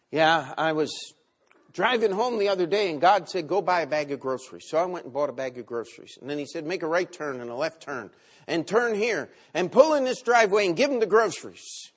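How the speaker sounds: loud, high, hammed-up retelling of a guy; excitable churchgoer bragging, mocked loudly; mock excited testimony. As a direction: ham up the eager guy's story, loud and bright. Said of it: "just tell you the funny story here".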